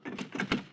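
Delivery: soft mocking laugh; short laugh. Murderer laughing at frightened friend, soft mocking laugh. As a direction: laugh at his fear.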